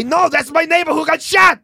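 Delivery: high, hammed-up action-hero wail; Arnold Schwarzenegger. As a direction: shout the news in a thick Austrian accent. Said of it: "your character's name".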